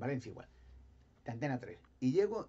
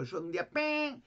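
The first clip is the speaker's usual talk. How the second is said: high ping effect; 11 semitones higher, 3 dB louder than usual. Real, not acted. Mimics the sound of a bomb.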